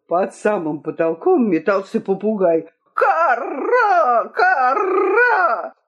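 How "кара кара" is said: high parrot screech with rolled r; squawking parrot. A narrator's reading. Parrot flapping under the ceiling, harsh rolled squawk. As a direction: caw it high, rolling a long r.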